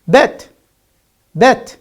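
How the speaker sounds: loud, high "bet" with voiced B; listening-test "bet", B with no puff; clear B sound. Shown as a demonstration. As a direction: punch "bet" as a minimal-pair example. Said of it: "Is it pa or ba?".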